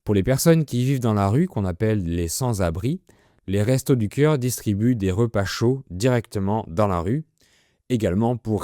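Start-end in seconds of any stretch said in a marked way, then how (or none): none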